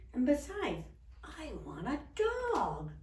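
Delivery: lightly acted child putting her foot down; insistent little girl. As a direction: stretch out the demand.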